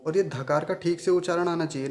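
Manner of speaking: normal talking voice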